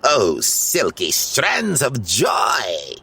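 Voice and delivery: very slow, swooning villain rapture; hammed-up ecstasy of a greedy wizard; rapturous villain. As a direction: croon it like a love poem. Gargamel rhapsodizes over hair.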